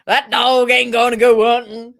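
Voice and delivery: hammed-up Southern accent. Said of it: "my best Southern accent".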